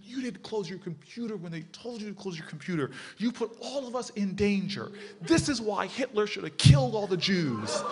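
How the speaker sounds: high-pitched, mounting outburst; hammed-up outraged accusation; imitated angry rant. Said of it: "angry as anything".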